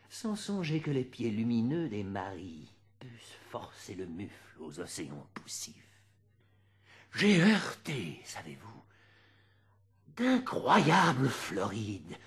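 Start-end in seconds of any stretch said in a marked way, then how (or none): 3.0-6.0 hushed whisper, much softer. Acted. Reciter drawing the audience close, whispered line. 8.2-8.8 low, hushed confiding whisper; whispered aside. Acted. Reciter confiding to listeners, low whispered aside.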